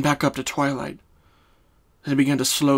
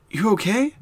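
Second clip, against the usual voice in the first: raised, gently concerned mare; Twilight's voice; 8 semitones higher, 3 dB louder than usual. Acted. Twilight checking on her dazed friend, concerned.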